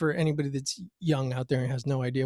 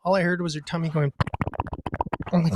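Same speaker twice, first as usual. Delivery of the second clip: usual speaking voice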